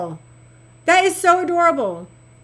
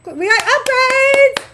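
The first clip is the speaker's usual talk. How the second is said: high, slow celebratory call; excited cheer; 9 semitones higher, 4 dB louder, slower than usual. Real, not acted. Streamer announcing new upgrades, excited high cheer. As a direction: draw it out high and gleeful.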